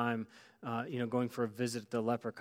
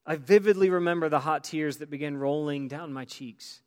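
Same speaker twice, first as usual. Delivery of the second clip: raised, louder, fervent pulpit storytelling; impassioned testimony reading; 4 semitones higher, 8 dB louder than usual. Acted. Preacher reads dying woman's promise to church, impassioned. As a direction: let the voice swell with emotion.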